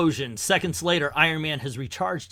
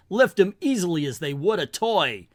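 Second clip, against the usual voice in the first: ordinary delivery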